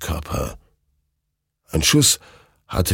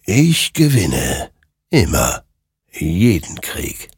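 otherworldly rider, triumphant; slower than usual. Acted. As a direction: intone each phrase slowly with finality. Rider's final word. (langsam, unheimlich und endgültig)

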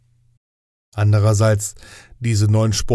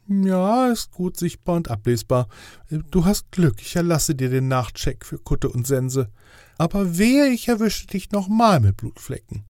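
higher, official angel voice; fussy inspector angel; 6 semitones higher than usual. Acted. Inspector angel grading Death's test, higher official voice. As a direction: play the strict inspector angel, pitched up.

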